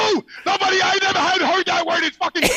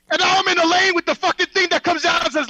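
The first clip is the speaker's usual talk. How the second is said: normal talking voice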